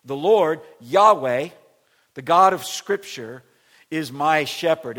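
loud, even emphasis on every phrase; loud proclamation. Shown as a demonstration. Pastor proclaiming the verse to church, loud. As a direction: shout the whole line out evenly. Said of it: "if you were gonna underline a word".

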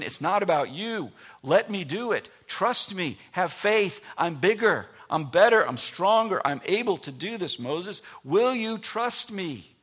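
high, loud, insistent divine plea; booming God. Acted. God pleading forcefully with Moses.

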